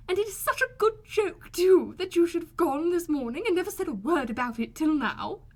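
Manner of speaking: high, loud, delighted gushing; gushing Mrs Bennet. A narrator's reading. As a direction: gush with rapture at the husband.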